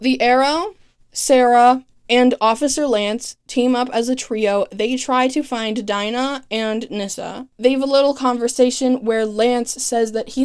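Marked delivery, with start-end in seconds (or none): none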